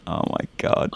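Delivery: breathless whisper of dread; a shocked whisper to himself; whispered dismay. Retold or imitated. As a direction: hiss it under your breath, alarmed. Scared aside seeing muggers again.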